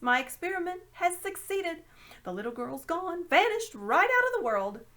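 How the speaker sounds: put-on a gloating old magician; triumphant old uncle. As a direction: crow over the experiment.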